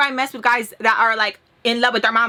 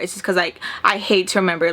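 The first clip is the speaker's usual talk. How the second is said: normal talking voice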